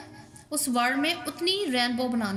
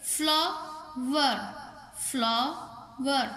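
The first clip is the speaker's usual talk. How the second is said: "Flower" slowly as फ्ला, pause, वर; split into syllables; 3 dB quieter, much slower than usual. Shown as a demonstration. Teacher counting two syllables, "flower" said in two parts. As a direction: break "flower" in two, each time. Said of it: "टू syllable".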